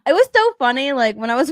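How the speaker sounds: usual speaking voice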